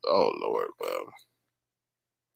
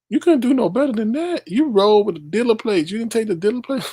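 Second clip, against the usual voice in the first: incredulous scolding; 10 semitones higher than usual. Real, not acted. Scolding the robber's photo.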